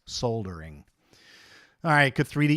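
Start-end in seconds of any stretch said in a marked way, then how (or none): 0.1-0.8 "Soldering" low and soft, L sounded; corrected British pronunciation. Shown as a demonstration. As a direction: say "Soldering" as "SOLE-dering", dropping low. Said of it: "I apologize".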